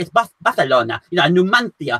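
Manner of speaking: "Barcelona" and "Numancia" with a Spanish th lisp; mock Castilian Spanish, "Barthelona", "Numanthia". Shown as a demonstration. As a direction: overdo the Castilian th sounds. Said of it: "how far are you gonna go".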